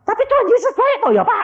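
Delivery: very high, insistent appeal; pleading question. Retold or imitated. Parent appealing to the pastor, pleading question.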